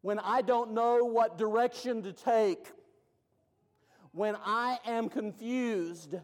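loud, high, slow preaching build; preaching at full force. Acted. Preacher building to the church, loud and slow. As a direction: cry it out, then land it slow.

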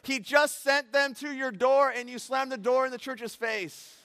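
high, loud exasperated yell; wanted to yell. Retold or imitated. Pastor yelling at the busy woman, exasperated. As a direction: let it burst out, slow and loud. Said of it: "I wanted to yell at her".

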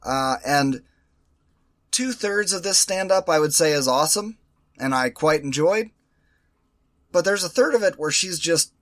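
ordinary delivery

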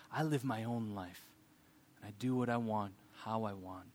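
low, hushed, acted stubbornness; soft and low self-willed declaration; quiet defiance. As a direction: drop right down, dig your heels in. Voicing the defiant mentality.

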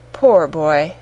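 low, sorrowful exclamation; pitying. A narrator's reading. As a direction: feel sorry for a lonely child. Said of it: "in pity for his former self".

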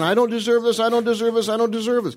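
high, pleading humble protest; humble Saul. Retold or imitated. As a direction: protest meekly, over and over. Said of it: "this humble man".

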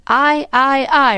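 lifted, ready chorus of assent; eager assent. A narrator's reading. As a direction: chime in like voters agreeing at once. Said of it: "replied three voices at once".

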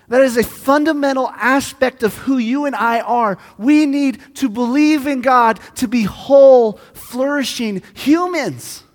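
loud, high, driving sermon voice; impassioned preaching. Real, not acted. Pastor urging the congregation to believe, impassioned preaching. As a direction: preach it with everything you have.

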